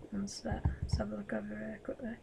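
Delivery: quick, hushed whisper while sneaking. Real, not acted. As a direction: whisper it fast, trying not to be heard. Sneaking around the ruins.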